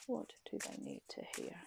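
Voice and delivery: voiceless whisper of the question; whispered ask. Real, not acted. Asking the tarot cards for a message, whispered.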